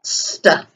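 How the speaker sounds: st hissed out alone; just the st sound, unvoiced; blend sound. Shown as a demonstration. Teacher isolating the st blend for children. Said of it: "work together to make the sound".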